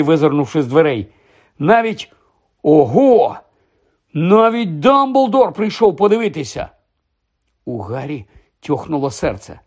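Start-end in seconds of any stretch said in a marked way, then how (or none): 1.6-6.7 high, excited burst of amazement; amazed excitement. A narrator's reading. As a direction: marvel at who turned up, voice leaping high. Fred excitedly reporting the crowd.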